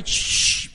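mother's sharp shushing sound; imitated hushing shh. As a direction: shush a noisy child. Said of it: "el pellizco de mi madre".

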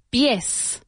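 normal talking voice

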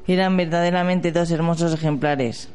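ordinary talk, nothing marked

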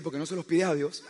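soft teasing jab through a laugh; laughing retort. Real, not acted. Husband throws her words back at wife, laughing softly. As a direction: tease her back, chuckling quietly.

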